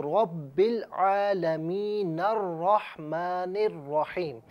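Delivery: slow, drawn-out Quranic recitation joining the words; drawn-out tajweed demonstration; slow joined recitation. Shown as a demonstration. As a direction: recite it slowly, linking the words. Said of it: "اگر ہم ملا کر پڑھیں مثال کے طور پر".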